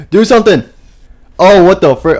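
loud, high-pitched outburst; panicked shout. Real, not acted. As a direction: yell at the game in alarm. Panicking at Foxy in the game.

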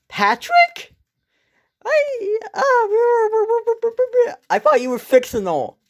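high squeaky amazed voice, almost laughing; amazed disbelief. Acted. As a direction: gasp it out like you can't believe he's real. Shocked to meet Patrick.

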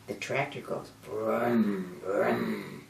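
slow low tractor rumble; low engine brum. Acted.

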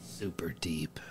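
hushed, mock-profound whisper; whispered aside. Co-host remarking on the creepy story, whispering. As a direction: drop to a whisper.

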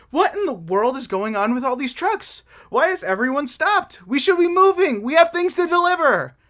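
rising frustration at the jam; exasperated truck. Acted.

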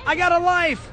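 usual speaking voice

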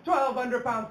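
usual speaking voice

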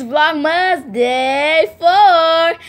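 FOUR stretched long and loud; drawn-out announcement. Real, not acted. Vlogger announcing Vlogmas day to viewers, drawn-out.

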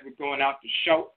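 "shout" said with an oh vowel, like "shote"; southern "shout", rhyming with "oat"; southern dialect pronunciation. Shown as a demonstration. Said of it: "in the southern dialect".

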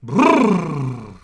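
buzzing lip trill, loud. Shown as a demonstration. Teacher demoing for kids, loud lip-trill buzz. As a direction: flap the lips loose and buzz. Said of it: "shake your lips out like this".

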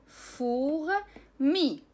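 فورمي stretched very slowly, every sound drawn out; فورمي read very slowly for learners; slow careful word. Shown as a demonstration. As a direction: drag out فورمي syllable by syllable for learners. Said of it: "نملة".

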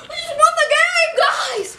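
high victory shout; shrieking jackpot winner. Real, not acted. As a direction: yell it out in triumph. Just won the game.

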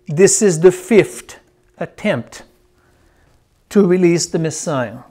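long pause before "to release the Messiah". Real, not acted. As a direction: dictate it phrase by phrase. Repeating the note for writers.

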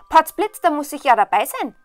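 loud, high burst of eagerness; excited simpleton. A narrator's reading. Simpleton hears of the princess contest, bursting with excitement. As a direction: exclaim it with gusto. Simpleton exclaims on hearing news.

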